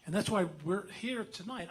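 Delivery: usual speaking voice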